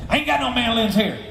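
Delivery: put-on high, quick cantankerous old-timer. Musician turning away the delivery man through the door, cantankerous. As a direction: refuse in a high caricature voice. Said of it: "hear this voice inside".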